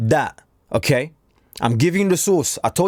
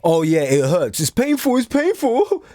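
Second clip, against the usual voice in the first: whiny, climbing much higher on painful; mock complaining; 4 semitones higher than usual. Mocking people who complain but never change, high whiny whinge. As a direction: mimic a moaning complainer in a high voice. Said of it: "that you are complaining".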